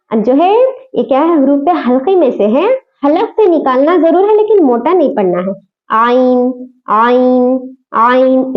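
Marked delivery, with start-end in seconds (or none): 5.9-8.5 आइन said thin from the throat, not heavy; teacher sounds आइन light; light Ain. Shown as a demonstration. Teacher shows students आइन light, not heavy. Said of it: "इस तरह से पढ़ना है".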